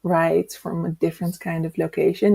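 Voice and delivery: ordinary delivery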